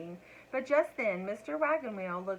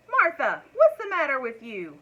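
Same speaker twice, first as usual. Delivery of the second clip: high, loud angry husband yelling at his wife; 5 semitones higher, 11 dB louder than usual. A narrator's reading. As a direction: bellow it at her across the table, egg all over him. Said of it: "yelled Mister Wagon Wheel".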